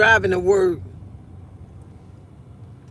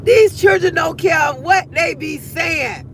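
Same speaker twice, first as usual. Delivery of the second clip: high, loud, slow declaration; amused shout; 6 semitones higher, 3 dB louder, slower than usual. Real, not acted. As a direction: proclaim it to everyone.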